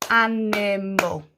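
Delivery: "animal" slowly split in three beats; "animal" drawn out, every syllable distinct. Shown as a demonstration. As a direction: clap out each syllable of "animal". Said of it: "clap our syllables".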